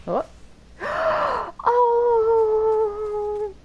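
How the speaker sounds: high gasp into a crying oh; overwhelmed, near tears with joy; delighted gasp. Real, not acted. Sees beloved bird companion.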